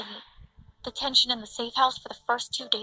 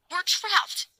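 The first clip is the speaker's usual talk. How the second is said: high, loud panicked mother; frantic, high-pitched panicked yell; hysterical scream; 7 semitones higher, 6 dB louder than usual. A narrator's reading. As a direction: cry out in hysterics, voice jumping up. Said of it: "My mother screamed".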